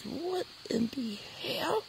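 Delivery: high, slow, strained what in the hell; stunned disbelief. Retold or imitated. Insulted boy reacting to the girl, stunned and high. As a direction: drag it out in outraged shock.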